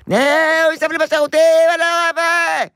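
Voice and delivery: put-on high-pitched wailing kid voice; whiny screaming child. Mother imitating her son screaming at her. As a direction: imitate the child's screechy complaint.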